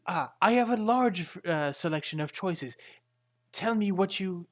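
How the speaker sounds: smooth over the offence and push the wares; apologetic merchant. Acted. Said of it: "And he says".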